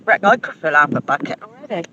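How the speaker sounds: usual speaking voice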